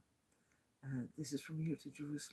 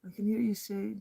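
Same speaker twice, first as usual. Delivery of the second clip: louder, in full voice; aloud; 5 semitones higher, 10 dB louder than usual. Real, not acted. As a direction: say it out loud this time. Repeated aloud after whisper.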